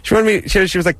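ordinary talk

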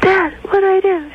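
high, pleading son voice; clueless son. Acted. Son on a walkie-talkie asks Dad for help, high and helpless. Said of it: "and he goes".

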